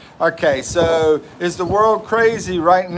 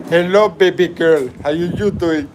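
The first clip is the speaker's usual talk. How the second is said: mimicking Akash; 3 semitones higher, 4 dB louder than usual. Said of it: "Akash actually said it like this".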